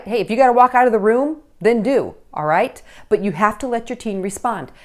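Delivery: ordinary talk, nothing marked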